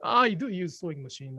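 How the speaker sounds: ordinary talk, nothing marked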